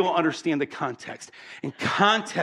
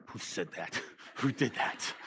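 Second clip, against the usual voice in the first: hushed reeling wife; 7 semitones lower, 13 dB quieter than usual. Retold or imitated. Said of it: "my wife's mind starts reeling".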